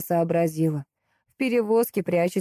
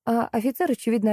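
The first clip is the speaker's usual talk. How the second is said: plain reading voice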